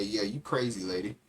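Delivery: ordinary delivery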